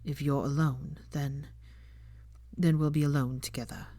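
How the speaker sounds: put-on low, hushed young man, gentle; lightly voiced young man, soft and reassuring; tender Draco. A narrator's reading. Draco comforting her.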